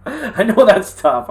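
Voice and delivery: amused, sympathetic laugh; laughing sympathy. Real, not acted. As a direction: chuckle while admitting it's hard.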